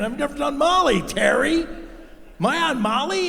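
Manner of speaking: high, loud panicky yelp; loud high alarm at being drugged; panicked. Retold or imitated. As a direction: freak out, voice shooting up.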